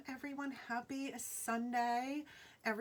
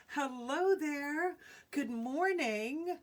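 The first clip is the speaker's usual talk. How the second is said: bright greeting; 5 semitones higher, 5 dB louder, much slower than usual. Real, not acted. Greeting viewers joining live stream.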